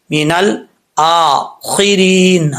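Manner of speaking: مِنَ الْآخِرِينَ slowly, the long aa held one alif; slow recited demo. Shown as a demonstration. Teacher models مِنَ الْآخِرِينَ slowly for class. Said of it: "ایک الف کی مقدار لمبا کریں گے".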